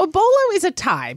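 high, fast and emphatic; emphatic high declaration. Acted. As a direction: proclaim it to everyone. Mock proclamation to people.

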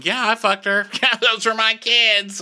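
high, laughing careless brag; mock brag. Lionel mocking his son's brag in a high, laughing voice. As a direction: blurt it like a proud fool.

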